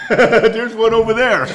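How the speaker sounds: loud, high, through real laughter; laughing. Real, not acted. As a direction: laugh as you call it out. Laughs while pointing someone out.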